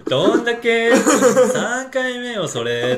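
high, loud, drawn-out camp queen voice; hammed-up onee comeback, stretching the words; camp onee character. Friend acts the comeback as an onee, camp and exaggerated. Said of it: "おねえになっちゃうね".

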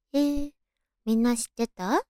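ordinary talk

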